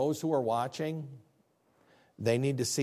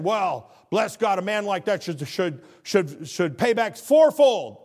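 indignant king; 6 semitones higher, 7 dB louder, slower than usual. Retold or imitated. David's indignant retort retold.

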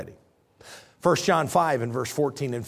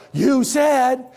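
put-on kids pleading; 7 semitones higher, 3 dB louder than usual. Kids voiced pleading for pizza.

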